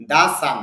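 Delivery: "the" said da before the consonant of sun. Shown as a demonstration. Teacher explains the before a consonant, "the" pronounced da. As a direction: say "the" with an open a, da. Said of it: "এখানে দা উচ্চারণ হবে".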